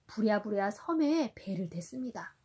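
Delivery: gentle storybook reading for a little one; reading to a toddler. A narrator's reading. Parent reading Sindbad to a child. (아이에게 동화 읽어주듯)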